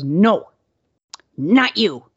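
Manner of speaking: curt, dismissive refusal; imitating a rude clerk saying no; mock gruff clerk. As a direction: shut her out flatly. Gas station clerk refusing her.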